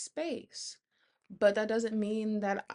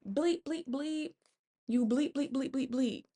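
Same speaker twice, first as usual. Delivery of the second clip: mock cuss-out; 5 semitones higher than usual. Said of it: "I really coulda came crazy".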